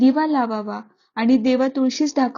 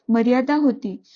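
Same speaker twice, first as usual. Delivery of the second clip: ordinary delivery, nothing marked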